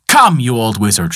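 ordinary delivery, nothing marked

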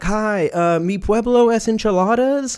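high, hammed-up American Spanish accent; mock gringo Spanish. Listener showing off bad Spanish to waiter, mock gringo accent. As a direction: squeak out tourist Spanish way too high. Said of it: "you're like".

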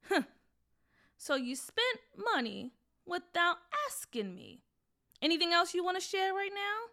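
a stern mother pressing for the truth. Acted. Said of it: "she asked very sternly".

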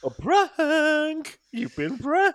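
yelling you've been pranked; high, loud gleeful shout; prank reveal shout. Real, not acted.

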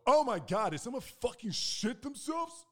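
high, loud horrified passenger; mock appalled outcry. Acted passenger in the back exclaiming.

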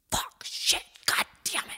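hushed, voiceless angry cursing; cursing to himself. Retold or imitated. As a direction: imitate a man muffled and swearing to himself. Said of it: "like, speaking to himself".

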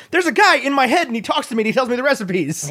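high, babbling nonsense-talker; quoted film character. Retold or imitated. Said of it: "He's like".

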